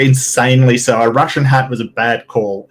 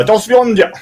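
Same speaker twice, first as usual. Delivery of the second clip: higher-pitched mock Russian farewell; Russian accent; 10 semitones higher than usual. Guest struggles through requested voice, rough Russian accent. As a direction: sign off in a put-on Russian accent. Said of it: "do that with a Russian accent now".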